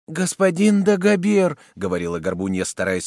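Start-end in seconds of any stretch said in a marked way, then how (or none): 0.1-1.6 high, loud, drawn-out appeal; pleading young woman. A narrator's reading. Hunchback girl trying to restrain the soldier, pleading. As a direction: call his name imploringly.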